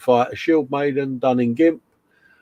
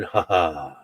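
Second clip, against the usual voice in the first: imitating a giggle; 7 semitones lower, 5 dB quieter than usual. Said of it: "he's just giggling with his cigar".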